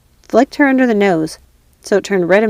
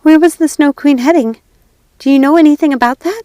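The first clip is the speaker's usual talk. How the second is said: higher, louder eager calling; 7 semitones higher, 6 dB louder than usual. Acted. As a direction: call up urgently. Gerda calling up.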